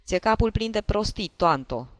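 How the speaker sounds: loud, high-starting angry outburst; narrated shout. A narrator's reading. Husband berates his foolish wife, shouting. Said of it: "țipă el".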